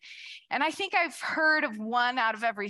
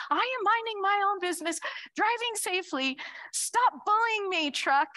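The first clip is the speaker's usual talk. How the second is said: higher, fast mock-indignant protest; playfully huffy complaint to a bully truck; indignant driver; 8 semitones higher than usual. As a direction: scold the truck in a prim, offended tone. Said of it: "And I'm like".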